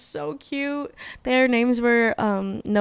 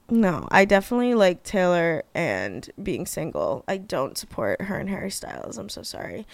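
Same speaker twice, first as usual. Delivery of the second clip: usual speaking voice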